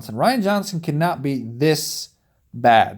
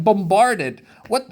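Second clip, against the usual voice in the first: normal talking voice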